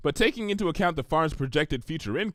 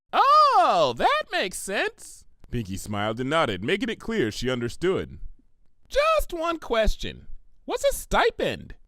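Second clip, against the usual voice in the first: bubbly pony; 6 semitones higher than usual. Acted. Narrator voices Pinkie.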